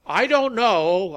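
high, loud, slow declaration; ringing public address. A narrator's reading. Rally leader to the suddenly silent crowd, voice ringing out. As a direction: let it ring out over a hushed crowd. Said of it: "Malcolm's voice echoed in the sudden silence".

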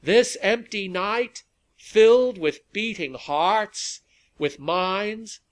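high, loud, measured oratory; rally speech. A narrator's reading. Rally leader two minutes before sunset, orating to the crowd. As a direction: ring out each phrase to stir a crowd.

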